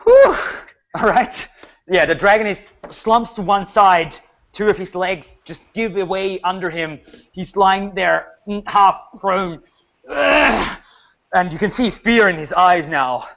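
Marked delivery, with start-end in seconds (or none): 0.0-0.3 sharp, high exhaled whew; loud whew. Real, not acted. Relief after a big hit. 10.1-10.8 high, pained groan; wounded dragon groan. Acted. Game master voices slumped dragon.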